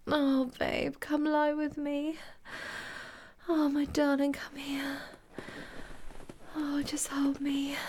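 soft, high tender murmur; tender whisper. Acted. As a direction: whisper softly to a lover. Tender afterglow.

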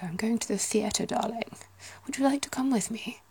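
lightly done posh English lady, whispered; mock posh English invitation. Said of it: "Sounds a bit posh".

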